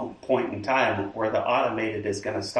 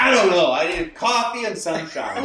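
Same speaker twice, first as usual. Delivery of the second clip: laughing mock excuse; 6 semitones higher, 4 dB louder than usual. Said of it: "I'm like".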